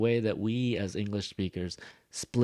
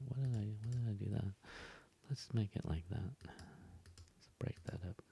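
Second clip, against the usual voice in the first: muttering to self; 13 dB quieter than usual. Real, not acted. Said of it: "mutters to self while drawing".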